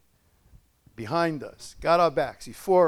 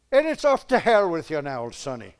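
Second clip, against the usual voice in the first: mock Irish accent; 6 dB louder than usual. Preacher playing God sentencing a sinner, mock Irish accent. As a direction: banish them in a hammed-up Irish accent. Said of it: "God was Irish now".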